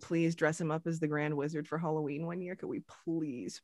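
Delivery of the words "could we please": soft pleading whisper; whispered plea. Real, not acted. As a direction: whisper the begging softly. Begging to dress the kid up.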